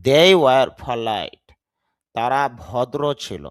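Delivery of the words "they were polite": polite with a strong ph, slow and loud; loud aspirated polite. Shown as a demonstration. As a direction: punch the puff in polite. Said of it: "মানে বাতাস বের হবে".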